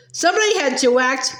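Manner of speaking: ordinary delivery, nothing marked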